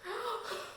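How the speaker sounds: a sharp, high gasp of delight; mock gasp. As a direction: gasp as if shocked by something funny. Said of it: "I was like".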